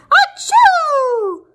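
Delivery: loud high sneeze; big sneeze. A narrator's reading. Wolf sneezes at the brick house, huge sneeze. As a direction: burst out the sneeze. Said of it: "I sneezed once again".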